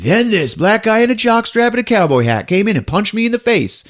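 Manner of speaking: high, lightly voiced complaining witness; mock witness. A suspect reporting the cowboy to disbelieving listeners, lightly voiced. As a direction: whine the implausible story. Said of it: "they would always tell that story".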